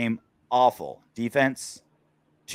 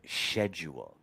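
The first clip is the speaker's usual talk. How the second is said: soft, low British schedule, SHED-yool; British pronunciation; 7 semitones lower, 9 dB quieter, slower than usual. Retold or imitated. Host repeats co-host's British word, soft British accent. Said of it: "like a hot British chick".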